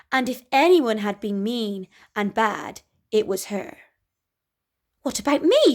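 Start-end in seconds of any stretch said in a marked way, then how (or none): 5.0-5.7 higher, louder indignant whine; mock screech. Cinderella complains to the fairy, loud screech. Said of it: "she screeched".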